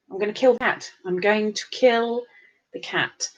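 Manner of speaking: ordinary talk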